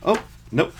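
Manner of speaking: ordinary talk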